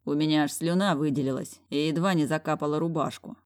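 ordinary delivery, nothing marked

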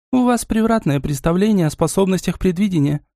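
understated didactic tone; mentoring tone. A narrator's reading. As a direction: correct them like a tutor. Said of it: "проговорила Или менторским тоном".

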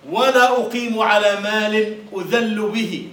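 slow, loud proud declaiming; poem recital. Acted. Teacher declaiming Mutanabbi's proud verse, loud and slow. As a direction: proclaim the verse with defiance.

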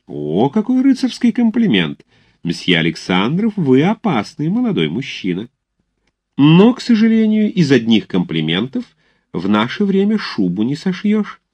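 high, lilting lady's voice; coquettish society mother, flattered. Acted. Mother teasing cadet over his compliment, high and coy. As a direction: swoop up high in playful admiration.

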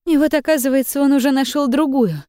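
telling it through tears; shaken tearful witness. Acted. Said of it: "проливая слёзы и шмыгая носом".

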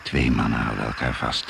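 normal talking voice

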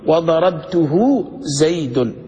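very slow, every syllable drawn out; slow model reading. Shown as a demonstration. Teacher shows students how to pronounce the sentence, slow and clear. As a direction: recite it like a grammar example on the board. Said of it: "قل".

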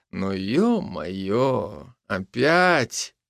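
slow, drawn-out cat's complaint; weary exasperation. A narrator's reading. Ginger cat seems fed up with the scene, wearily. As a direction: sigh the words out, stretching the first. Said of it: "словно хотел сказать".

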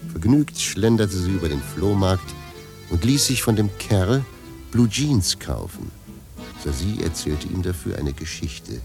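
ordinary talk, nothing marked

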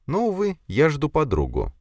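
high-pitched lady's polite refusal; coy lady. A narrator's reading. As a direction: let him down coyly in a high voice. Lady turns down the count.

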